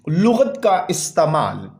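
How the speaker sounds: ordinary talk, nothing marked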